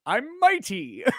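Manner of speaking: high, drawn-out proud declaration; the Tick. Acted. Fan retelling superhero's interview answer, high mock-proud boast. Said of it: "And he's like".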